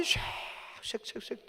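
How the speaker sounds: long hiss, then quick short swishes; whooshing wind imitation. Preacher shows draught through car window, wind imitation. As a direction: blow the draught out as sound.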